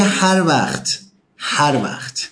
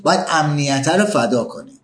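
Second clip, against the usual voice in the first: usual speaking voice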